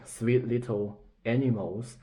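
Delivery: normal talking voice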